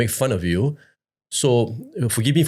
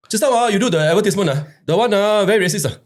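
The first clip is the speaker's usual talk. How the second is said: higher, rapid-fire aggrieved caller; grumbling caller with a raised pitch; complaining caller; 9 semitones higher, 3 dB louder, faster than usual. Retold or imitated. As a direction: mimic an indignant phone-in complainer. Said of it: "I want to complain".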